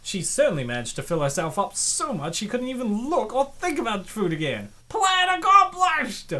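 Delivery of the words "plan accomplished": mock triumph. Sarcastic cheer at the plan.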